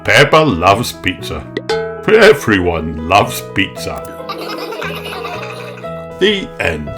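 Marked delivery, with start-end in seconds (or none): none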